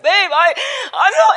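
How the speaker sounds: high, loud imitation of tearful distress; retold crying. Upset speaker calling partner on a bad day, retold crying. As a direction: re-enact a distraught wail. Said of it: "I was just so sad and just crying".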